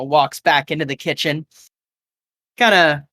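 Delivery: ordinary talk, nothing marked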